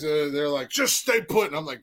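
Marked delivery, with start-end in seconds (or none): 0.7-1.5 cop order. Retold or imitated. Cop's order retold.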